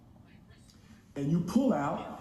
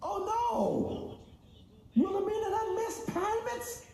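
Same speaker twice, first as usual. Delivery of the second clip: high, soft falsetto dismay; mock shock; 7 dB quieter than usual. Customer scolding his own company in mock dismay. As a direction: squeal it like a scandalized auntie.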